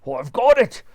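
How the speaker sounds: husband struck by an idea; cried out. Acted. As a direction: burst out with the idea. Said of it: "cried Mister Twit".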